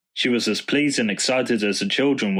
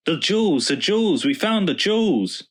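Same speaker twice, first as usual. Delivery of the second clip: excited shouting; 8 semitones higher than usual. A narrator's reading. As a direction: let it burst out, jumping for joy. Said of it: "hopped up and down with excitement".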